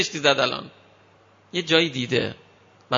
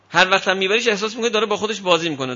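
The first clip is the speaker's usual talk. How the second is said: ordinary delivery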